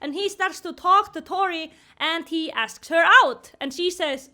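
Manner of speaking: ordinary talk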